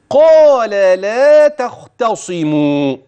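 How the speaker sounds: slow chant of قال لا تختصموا, thick ق and خ, thin ل and ت; heavy and light letters. Shown as a demonstration. Teacher reciting قال لا تختصموا to show heavy versus light letters. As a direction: alternate heavy and light through قال لا تختصموا, long vowels. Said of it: "مع التفخيم والترقيق".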